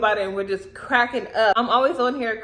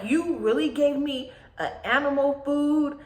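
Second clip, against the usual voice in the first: mad disbelief; 3 semitones higher than usual. Retold or imitated. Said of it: "I was mad".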